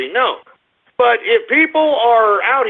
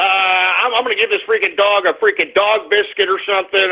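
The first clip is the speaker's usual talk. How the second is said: usual speaking voice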